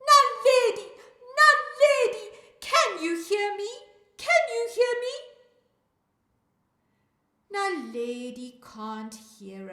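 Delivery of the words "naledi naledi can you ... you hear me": very loud, high-pitched yell; shout. Acted. Storyteller calls Naledi after counting to three, full shout. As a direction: shout at the top of your voice. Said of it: "We're going to shout after counting to three".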